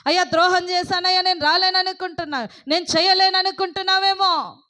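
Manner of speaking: high, loud, driving sermon voice; full-force preaching. Acted. Preacher rousing the congregation against fear, high and loud. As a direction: thunder God's promise over the crowd.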